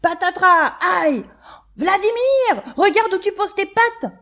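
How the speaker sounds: loud, high indignant cry of pain; protesting. Acted. Worm scolding the clumsy scarab, loud and high. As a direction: yelp then scold him sharply. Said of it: "proteste Eric".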